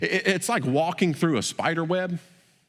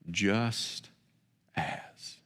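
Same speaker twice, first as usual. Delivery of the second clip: soft emphasis; 6 semitones lower, 6 dB quieter than usual. Shown as a demonstration. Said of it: "Can we, can we say that together?".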